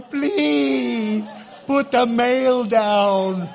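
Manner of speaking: loud, slow begging whine; the hungry dog begging its owner to put the mail down, in a loud whine; pleading dog. Pretend. Dogs plead to the distracted owner.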